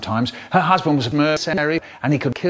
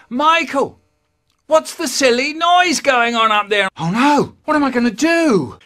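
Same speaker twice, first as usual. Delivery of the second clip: higher and louder, calling from downstairs; mum calling upstairs; 12 semitones higher, 7 dB louder than usual. Retold or imitated. Mum calling up to Michael, high and loud. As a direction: call up the stairs like a fussing mum. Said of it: "my mum from downstairs going".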